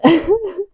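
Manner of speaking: short high laugh, out of breath; winded laugh after effort; breathless laugh. Real, not acted.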